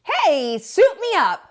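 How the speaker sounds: Tacky volunteers with gusto; eager cry. Acted. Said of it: "cried Tacky".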